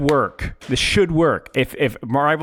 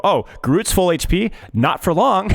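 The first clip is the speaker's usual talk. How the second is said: higher-pitched, drawn-out gleeful spotting of prey; mock sudden realisation; 7 semitones higher, slower than usual. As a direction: act out pouncing on an opening. Voices player spotting an opportunity.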